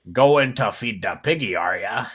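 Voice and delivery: a pirate leering over the captive's shoulder; pirate accent. Acted. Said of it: "It's a pirate".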